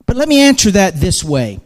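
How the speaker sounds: ordinary delivery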